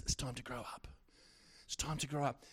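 God's word, hushed and low; a soft, low voicing of God's command; quiet. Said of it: "But he says".

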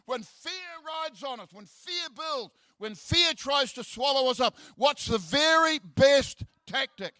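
rising preacher's cadence, pitch up and pushed; preaching. Acted. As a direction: build the point like a pulpit call. Preaching build-up to the point.

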